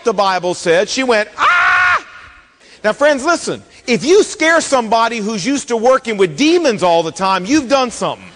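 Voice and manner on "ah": sudden high piercing scream; terrified shriek. Retold or imitated. Preacher acting the medium's terrified high shriek. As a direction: shriek like a woman seeing the dead rise. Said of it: "She shrieked out".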